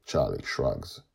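usual speaking voice

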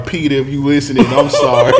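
ordinary talk

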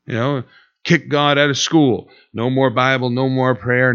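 ordinary delivery

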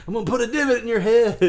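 high, fast, hammed-up tough guy threat; mock menacing threat.

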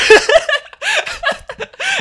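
long, loud high laughter; high shrieking laugh. Real, not acted. Friend cracking up over the exploding family, shrieking laugh. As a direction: laugh helplessly, squealing.

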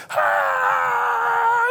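long, high, loud terrified scream; falling scream. Acted. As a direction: scream while plunging down. Herbie dropped from the sky.

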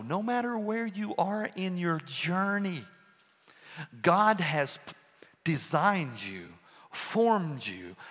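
usual speaking voice

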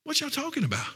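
healed man baffled by the accusers, high and soft; puzzled healed man. Retold or imitated. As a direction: shrug off their questions in disbelief. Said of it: "that blind man ... is sitting there going".

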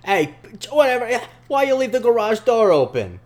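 put-on loud, high New York accent, neighbor's gripe; hammed-up New Yorker complaining. Mocking the neighbor scolding his son, New York accent. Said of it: "He's not from New York, man".

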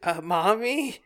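put-on bewildered boy calling his mommy; little boy Tim. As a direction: play a frightened young boy. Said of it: "this is Tim, little boy Tim".

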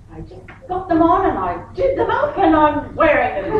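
high, loud insistent protest; hammed-up indignant comeback; mock defiance. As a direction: imitate a daughter stubbornly defending herself. Daughter defiantly answers mum.

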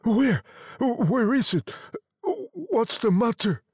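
high-pitched, startled questions; alarmed. A narrator's reading. Husband woken with a start, alarmed. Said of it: "He stared up in alarm".

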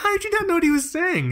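very high-pitched, disbelieving question; incredulous. Retold or imitated. Son questioning his dad about the doctor, incredulous. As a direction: voice leaps up in mock outraged disbelief.

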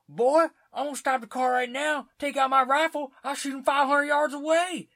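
high, loud blustering civilian voice; wannabe Rambo. Retold or imitated. Boasting to Jeff Bridges' character, high and loud. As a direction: play a cocky Rambo type at the top of your range. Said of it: "thinks he's Rambo".

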